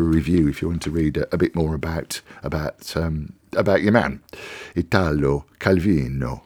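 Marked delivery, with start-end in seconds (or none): 4.7-6.4 the name Italo Calvino said with a light Italian accent, Ee-TAH-lo Cal-VEE-no. Real, not acted. Radio host naming the author, in a light Italian accent. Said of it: "I don't know why I did the accent".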